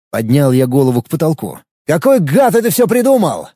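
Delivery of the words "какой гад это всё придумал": frustrated shout, high pitch; shouting at the sky. Acted. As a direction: yell it at the ceiling. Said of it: "Прокричал, никому не обращаясь".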